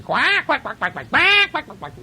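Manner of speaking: high quick quacks; duck quacking. A narrator's reading. Reader voicing ducks for children with high quacks. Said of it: "And the ducks were going".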